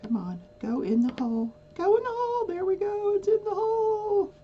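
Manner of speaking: high coaxing voice, rising excitement; coaxing, straining. Real, not acted. Coaxing diamond drill into hole.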